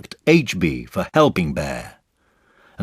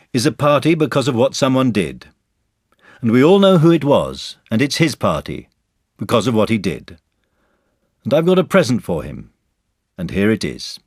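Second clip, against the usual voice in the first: higher, louder speech-making voice; lightly voiced Christopher Robin; 6 semitones higher, 6 dB louder than usual. Christopher Robin presenting a gift at the party, announcing.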